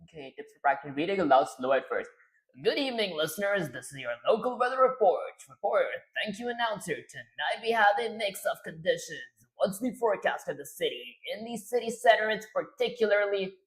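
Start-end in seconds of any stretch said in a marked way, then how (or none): 2.6-13.6 upbeat weather reporter read. Acted. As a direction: read it like a TV forecast. Reads weather report script aloud.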